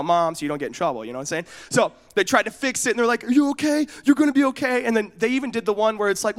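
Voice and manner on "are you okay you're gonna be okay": high, urgent fussing; acted reassurance. Cousins hushing hurt boy before mom knows, high urgent reassurance. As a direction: hurriedly placate a crying kid. Cousins try to fix crying child.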